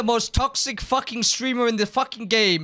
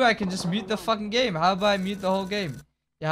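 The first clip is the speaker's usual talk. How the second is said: normal talking voice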